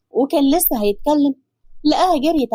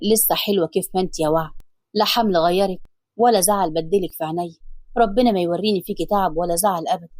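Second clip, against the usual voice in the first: lower, tender husband's voice; loving man's voice, lower; Hassan's voice; 6 semitones lower than usual. Acted. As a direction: soothe her as her husband, lower.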